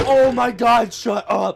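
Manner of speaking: high, loud exasperated yell; retold scream. Boy retelling how he screams at woodpeckers behind his bed, exasperated yell. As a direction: yell at noisy pests through a wall, acting it out. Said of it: "I'll, like, scream".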